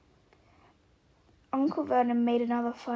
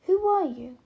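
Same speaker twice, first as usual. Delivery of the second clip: put-on startled boy's question; 6 semitones higher, 6 dB louder than usual. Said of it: "what he said was".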